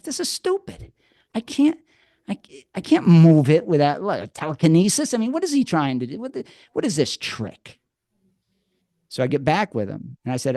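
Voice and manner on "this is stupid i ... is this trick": high, fast, exasperated retold rant; frustrated panic. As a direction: play his younger self losing it over an impossible task. Retold inner panic over the lesson.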